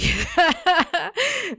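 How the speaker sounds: a burst of high laughter; loud laugh. Real, not acted. Laughs at Zoe line.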